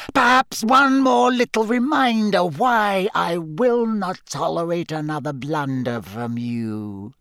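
villain starting high, sinking to normal pitch; cold voice. Acted. Voldemort threatening Wormtail for his blunder, cold voice. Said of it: "said the cold voice".

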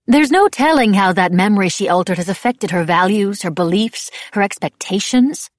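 loud, forceful reproach; berate, piling up the consequences; Madame Labelle, angry. A narrator's reading. Angry woman berates the girl.

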